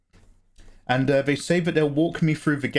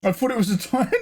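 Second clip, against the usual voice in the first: laughing; 13 semitones higher, faster than usual. Real, not acted. Breaks into laughter.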